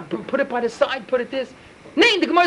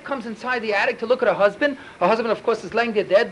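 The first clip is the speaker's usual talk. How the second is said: normal talking voice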